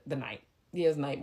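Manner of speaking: usual speaking voice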